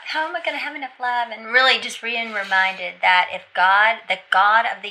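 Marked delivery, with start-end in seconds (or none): 0.1-1.3 quick, higher fretting question; retold upset. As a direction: rush out a retold worry. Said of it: "upset about".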